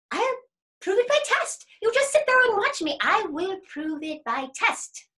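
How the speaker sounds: high, loud cocky rabbit voice; boastful rabbit. Acted. Rabbit promising the bear a test, cocksure.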